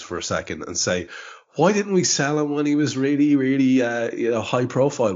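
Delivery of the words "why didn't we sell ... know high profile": high, whining complaint; whiny Twitter voice. Retold or imitated. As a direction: whine like an annoyed fan online. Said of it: "adapt one of the whiny, um, Twitter voices".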